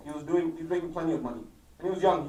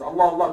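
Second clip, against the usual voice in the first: "Allah, Allah" given loud weight; emphatic appeal; 6 dB louder, faster than usual. Retold or imitated. Preacher translating the Imam's last words, emphatic "Allah, Allah". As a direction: punch out "Allah, Allah" as a warning. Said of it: "he's emphasizing".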